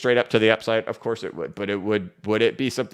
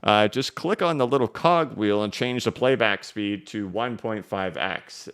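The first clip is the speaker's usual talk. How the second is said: usual speaking voice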